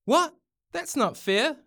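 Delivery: high, outraged protest; indignant shout. A narrator's reading. Louis objects to the scores being reset, shouting. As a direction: cry out in high-pitched disbelief. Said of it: "Louis shouted".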